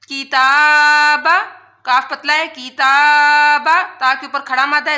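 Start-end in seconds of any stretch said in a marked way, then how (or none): 0.1-1.3 کتاب slow and louder, the تا vowel held long; stretched recitation. Shown as a demonstration. Teacher reciting کتاب slowly and loud for students. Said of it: "اس کو لمبا کیا". 2.5-3.7 کتاب slow and louder, the تا vowel held long; stretched recitation. Shown as a demonstration. Teacher reciting کتاب slowly and loud for students. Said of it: "اس کو لمبا کیا".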